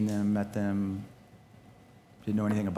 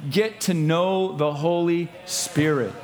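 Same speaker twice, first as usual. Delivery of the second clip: high, loud preacher delivery; full-force preaching; 10 semitones higher, 5 dB louder than usual. Real, not acted. Preacher urging the church to let the Spirit lead, full-force preaching. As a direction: drive it home to the congregation, loud and high.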